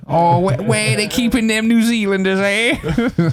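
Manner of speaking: high, exaggerated Aussie voice; hammed-up Australian accent. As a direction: put on a cartoon Australian accent, pitched high. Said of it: "G'day, mate".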